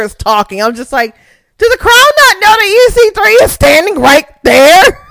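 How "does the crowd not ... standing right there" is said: high-pitched incredulous outburst; outraged disbelief. Retold or imitated. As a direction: squeal it in exasperated disbelief. Outraged reaction to silent crowd.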